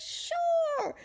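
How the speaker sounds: high, loud yes; eager agreement. Retold or imitated. Judge agreeing to a fan's picture, eager. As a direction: agree in a sing-out high pitch.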